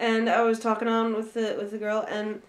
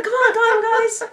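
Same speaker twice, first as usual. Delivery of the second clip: high, loud singalong urging; laughing rallying cry; 12 semitones higher, 8 dB louder than usual. Pretend. Urging guys into the camp song, loud laughing rally. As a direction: cheer them on through laughter.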